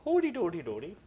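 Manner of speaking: high, loud mock-Swedish singsong; mock Swedish accent. Shown as a demonstration. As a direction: belt out a silly Swedish impression. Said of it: "my Swedish".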